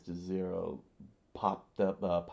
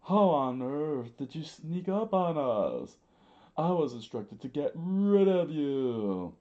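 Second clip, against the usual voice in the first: loud, high character voice with swooping words; over-the-top screamed outburst; theatrical scream; 8 semitones higher, 7 dB louder than usual. Acted. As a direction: holler it indignantly, pitched up. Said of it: "Mister Two screamed".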